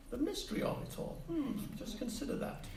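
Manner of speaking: mock mysterious whisper. Speaker teasing the audience about his vanishing work, whispered.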